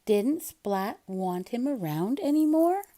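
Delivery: plain reading voice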